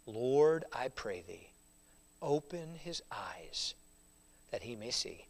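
soft, slow, half-whispered plea; whispered prayer. Acted. Elisha praying for his servant's sight, whispered. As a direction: pray it under your breath, slowly. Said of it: "Elisha prayed and said".

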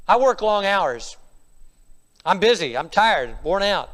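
loud, defensive dad; excuse-making father. Acted. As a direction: protest it like a put-upon father.

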